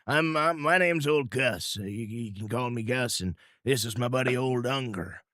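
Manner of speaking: a lightly voiced old trapper; frontiersman voice. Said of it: "coonskin hat looks up and goes".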